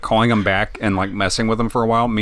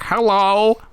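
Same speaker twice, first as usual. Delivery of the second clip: put-on much higher pitched sweet old lady; 11 semitones higher than usual. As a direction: answer the phone as a little old lady. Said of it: "like, an old lady voice".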